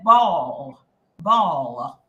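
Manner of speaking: low, dictated "ball" with a regional vowel; English teacher's pronunciation. Retold or imitated. English teacher dictating a spelling word, low regional "ball". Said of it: "one of the words she said".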